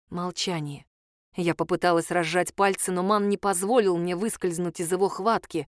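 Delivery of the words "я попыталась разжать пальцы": ordinary delivery, nothing marked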